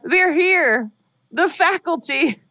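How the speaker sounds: high, urgent frightened whisper-free warning; scared warning. Retold or imitated. Terrified son warning his father, high and urgent. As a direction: warn him in fear, the last phrase slow.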